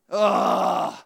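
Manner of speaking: loud, exasperated "ugh"; exasperated groan. Real, not acted. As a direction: groan loudly in disgust. Disgust at pushy salesmen.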